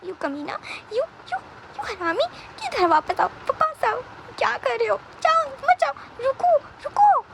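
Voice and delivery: high, strained calling after someone leaving; desperate captive's cries, held back in volume; acted distant yelling, pitch way up; yelling from background. A narrator's reading. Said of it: "Vibhushan background में चिल्ला रहा था".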